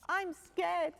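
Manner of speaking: high, small frightened cub voice; Little Bear. A narrator's reading. Little cub telling dad he's scared. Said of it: "said Little Bear".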